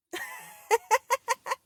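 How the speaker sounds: high, loud burst of giggles; real high cackling laugh; amused laugh. Real, not acted. Amused by a viewer's comment.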